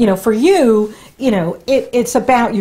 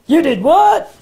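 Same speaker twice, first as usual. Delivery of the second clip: mock disbelief; 6 semitones higher than usual. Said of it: "You know, and".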